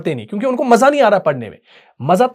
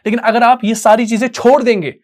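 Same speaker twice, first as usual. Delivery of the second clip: ordinary talk, nothing marked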